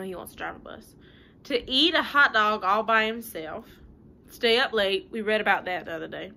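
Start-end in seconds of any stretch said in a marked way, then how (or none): none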